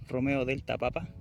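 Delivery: usual speaking voice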